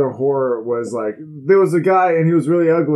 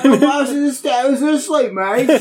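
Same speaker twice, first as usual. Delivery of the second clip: much higher hammed-up British echo; mock British accent; 13 semitones higher, 4 dB louder than usual. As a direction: parrot the line like a silly Brit. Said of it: "read in a British accent".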